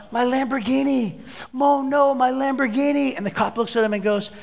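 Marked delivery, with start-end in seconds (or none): 0.1-3.2 high wailing over the wrecked car; distraught car owner. Retold or imitated. Crash victim wailing to the cop about his car. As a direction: wail for the lost Lamborghini.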